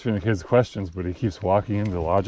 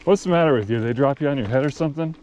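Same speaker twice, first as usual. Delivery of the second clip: exasperated Billy Bob; 6 semitones higher than usual. Retold or imitated. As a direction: snap at the kid in mild disbelief. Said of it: "finally he goes".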